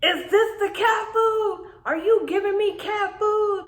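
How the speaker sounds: high, shrill-pitched disbelieving outburst; outraged kid. Retold or imitated. Little sister confronting her brother over cat food, high outraged yell. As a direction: go off on him, pitch way up. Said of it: "I was just going off".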